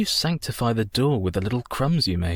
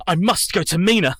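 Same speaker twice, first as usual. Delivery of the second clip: urgent cry; 8 semitones higher, 5 dB louder than usual. Acted. Urgent realisation, rushing to Mina.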